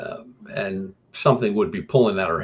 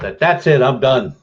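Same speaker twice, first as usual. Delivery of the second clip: retold fed-up shout; 4 semitones higher, 7 dB louder than usual. Scared man quitting the job.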